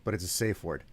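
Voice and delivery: ordinary delivery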